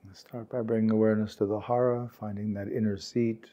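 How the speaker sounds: calm, unhurried meditation guide, words spaced by long pauses; guided meditation. Real, not acted. Meditation teacher guiding warm-hearted peers to relax, calm and unhurried. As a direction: gently lead a sitting group into stillness, leaving space.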